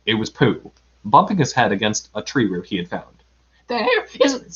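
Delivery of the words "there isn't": high, broken-off squeak; frightened Piglet. A narrator's reading. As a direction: squeak it fearfully. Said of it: "said Piglet".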